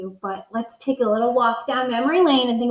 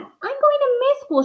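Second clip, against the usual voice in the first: sad. Acted. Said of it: "She looked sad".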